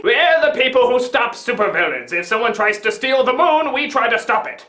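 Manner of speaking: low, measured official voice; lightly voiced professor. Professor explains the agency's job, lightly voiced low. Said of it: "Professor Melting Double Chin is like".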